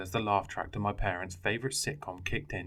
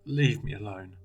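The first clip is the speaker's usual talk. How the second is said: pleading; 3 dB louder than usual. Acted. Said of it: "I pleaded".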